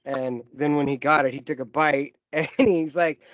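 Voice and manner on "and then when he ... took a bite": usual speaking voice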